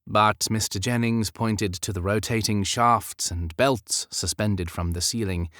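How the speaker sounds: ordinary delivery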